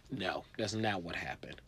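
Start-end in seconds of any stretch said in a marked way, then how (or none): none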